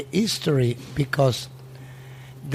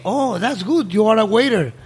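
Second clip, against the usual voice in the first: imitating a pleased boss, pitched higher; bright, eager surprise, voiced higher; delighted owner; 6 semitones higher than usual. Owner delighted hearing he's waiter.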